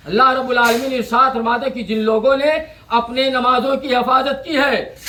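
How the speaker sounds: usual speaking voice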